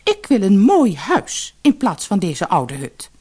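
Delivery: bossy wife stating her wish; demanding wife. A narrator's reading. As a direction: insist on it forcefully.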